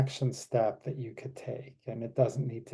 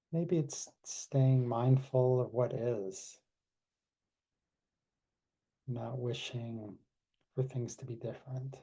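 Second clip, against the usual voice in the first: guided meditation. Acted. Leads closing meditation.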